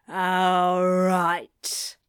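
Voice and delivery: low, drawn-out brush-off; weary man ending the chat; reluctant agreement. A narrator's reading. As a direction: say yes just to escape. Curt brush-off.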